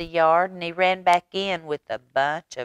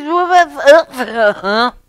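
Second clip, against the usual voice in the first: startled wake-up; 10 semitones higher, 11 dB louder, much slower than usual. Acted. Mama startled awake.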